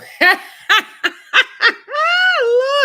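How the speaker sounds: high, loud whooping laugh; shrieking with laughter. Real, not acted. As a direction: burst out laughing. Laughing at nerdy glasses joke.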